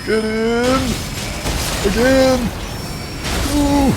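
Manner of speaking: put-on high, strained old man voice that sounds like he's in pain. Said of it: "I need to stop doing that old man voice".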